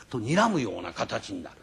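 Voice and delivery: normal talking voice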